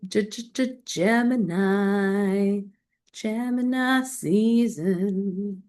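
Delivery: slow, stretched-out Gemini season; drawn-out announcement. Acted. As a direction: draw each word out like a fanfare.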